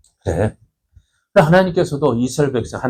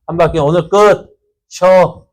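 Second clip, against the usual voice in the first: loud, higher-pitched drill call; commander's order; 4 semitones higher, 5 dB louder than usual. Acted. Commander ending the day's march round Jericho, loud order. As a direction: call it out like dismissing troops. Acts army commander dismissing soldiers.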